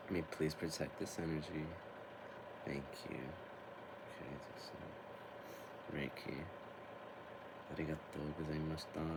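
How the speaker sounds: quiet Reiki moment, nearly under breath; hushed reverence. Real, not acted. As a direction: protect the sacred energy gently. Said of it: "This is a very sacred part".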